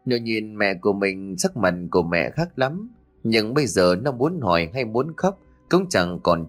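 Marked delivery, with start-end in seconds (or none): none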